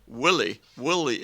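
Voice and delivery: Willie said the Scottish way, a light Scottish lilt. Shown as a demonstration. Streamer demos the Scottish way of saying it, lightly Scottish. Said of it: "in Scotland".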